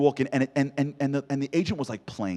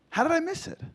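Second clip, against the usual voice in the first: amazed. Retold or imitated. Amazed self-quote.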